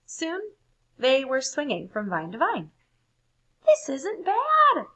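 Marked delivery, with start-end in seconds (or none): 3.7-4.9 high, loud and slow shout; gleeful high shout. A narrator's reading. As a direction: yell it out while swinging. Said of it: "shouted Floyd".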